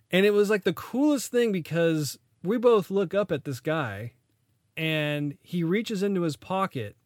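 ordinary talk, nothing marked

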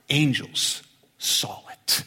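hushed secret reveal; slow breathy whisper of angels. Shown as a demonstration. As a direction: whisper the answer slowly. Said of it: "I'll tell you who saw it".

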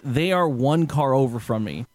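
usual speaking voice

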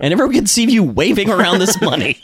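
high-pitched mock reprimand; exasperated scolding. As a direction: scold a careless friend, imitating exasperation. Retold scolding of loud friend.

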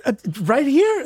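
startled reply. Acted. Flustered agent answering the Russian client, startled and high. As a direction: caught off guard, squeak it out as a question. Agent caught off guard by request.

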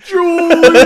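loud, high mock scream of Julia; hammed-up anguished yell; screaming a name. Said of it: "just starts screaming".

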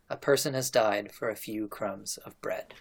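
low, soft, mournful line; understated sorrow. A narrator's reading. House serfs mourn the drowned boy, understated sorrow. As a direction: say it softly with a touch of grief. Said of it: "wept and said in a single voice".

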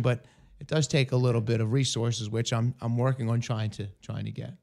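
hoarse, voice almost gone; lost voice, raspy and weak; raspy whisper. Real, not acted. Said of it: "I know I have no voice".

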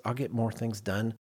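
ordinary talk

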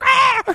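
very high, loud squawk; hammed-up screech; mock crow shriek. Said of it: "it's like".

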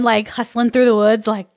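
ordinary talk, nothing marked

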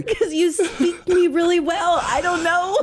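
high laughing voice. Real, not acted. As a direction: giggle through the words. Laughing while answering.

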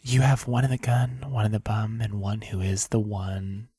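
soft, low ASMR voice. Pretend. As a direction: breathe it close, like ASMR. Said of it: "our very first ever A S M R".

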